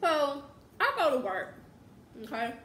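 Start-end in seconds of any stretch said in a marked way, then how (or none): none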